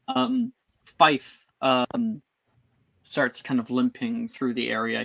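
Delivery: ordinary talk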